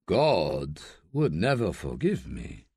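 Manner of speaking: put-on low, slow old man's voice, scornful; an ailing father mocking his son, slow and low; a slow low old man, needling; gruff old father. Rubio's voice again.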